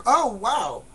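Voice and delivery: acted wow. Acts out receiving a welcome offer.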